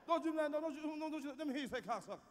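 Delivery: ordinary talk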